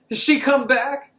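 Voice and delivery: high, loud, slowed question; imitated eager Jody. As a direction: press the bartender with a put-on urgent question. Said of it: "He's like".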